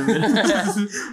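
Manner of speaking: burst of laughter. Real, not acted. Laughs at own joke.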